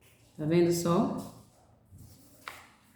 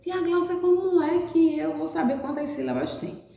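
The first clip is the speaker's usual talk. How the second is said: put-on high childlike voice asking teacher; pretend child asking; 7 semitones higher than usual. Teacher voicing a pupil's question, high and childlike. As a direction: ask like a puzzled pupil. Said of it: "Aqui eu tenho a palavra floresta".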